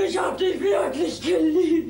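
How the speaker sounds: slow, loud, pleading cry; spurned woman crying out her love to him; anguished outcry. Acted. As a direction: burst out with it, heartbroken. Olga's outburst of love.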